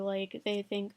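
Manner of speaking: ordinary talk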